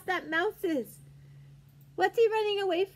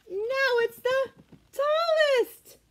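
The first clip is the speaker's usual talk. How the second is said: climbing much higher and louder, slow; thrilled tallest; 8 semitones higher, 4 dB louder, slower than usual. Acted. Reading to children, pitch soaring with excitement. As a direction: soar up on tallest. Building comparison game with children.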